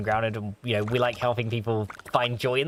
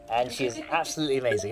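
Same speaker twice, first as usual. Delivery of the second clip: usual speaking voice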